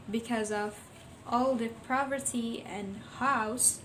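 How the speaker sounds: plain reading voice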